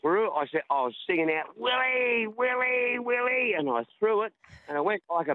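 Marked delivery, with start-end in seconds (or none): 1.6-3.5 high, ringing call to the stage; hailing a singer from the crowd, pitched way up. Retold or imitated. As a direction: sing out his name to get his attention. Calling out to singer at concert.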